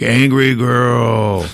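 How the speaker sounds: very slow, drawn-out brute call; Hulk. Retold or imitated. Hulk calling Valkyrie over, slow drawn-out greeting. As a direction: stretch both words as Hulk. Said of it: "he's like".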